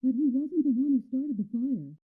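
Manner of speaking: distant echo. Acted. Mary's thought heard first.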